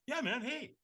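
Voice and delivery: hushed, easygoing reassurance; placating. Retold or imitated. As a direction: back off gently and lightly. Stranger backing off after intense refusal.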